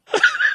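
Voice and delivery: sudden high squealing laugh, real; cracking up, unable to hold it in; burst of laughter. Real, not acted. Telling the fart story, bursting into high laughter.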